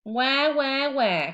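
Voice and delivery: loud mock baby crying; hammed-up baby wail; baby teeth wailing. A narrator's reading. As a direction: wah like a baby. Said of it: "cried the baby teeth".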